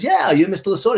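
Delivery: high, eager-to-please acted reply; placating supervisor. Supervisor assuring the celebrity he knows him, placating.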